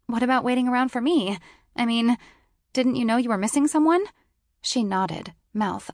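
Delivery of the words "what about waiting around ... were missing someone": indignant. A narrator's reading. Narrator defensively accusing.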